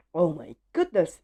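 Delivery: plain reading voice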